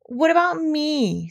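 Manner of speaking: high, slow plaintive question; sad. A narrator's reading. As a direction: plead slowly, feeling left out. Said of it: "said Pooh sadly".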